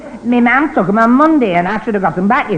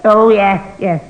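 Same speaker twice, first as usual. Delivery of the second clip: ordinary delivery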